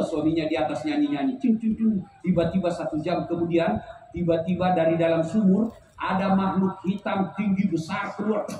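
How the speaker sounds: ordinary talk, nothing marked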